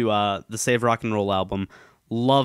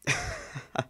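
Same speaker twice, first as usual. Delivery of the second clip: brief high, soft chuckle; short laugh; 8 dB quieter than usual. Real, not acted. Vlogger waiting on a console update, short soft laugh. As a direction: let out a small quiet laugh.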